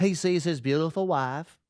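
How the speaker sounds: put-on echoing the Aussie host in accent; Australian accent. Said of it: "Wow, Hobie got in there".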